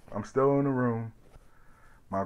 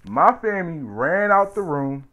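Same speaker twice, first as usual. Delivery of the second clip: normal talking voice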